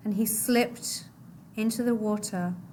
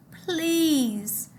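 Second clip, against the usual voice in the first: high desperate plea; high pleading boy's cry; 7 semitones higher than usual. Acted. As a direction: cry out for mercy. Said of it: "Mahmoud cried".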